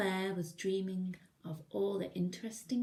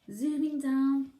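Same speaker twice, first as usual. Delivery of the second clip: loud, slow, higher zoom; thrilled aside while showing the picture; excited aside; 7 semitones higher, 9 dB louder, slower than usual. Real, not acted. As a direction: draw out the zooming down.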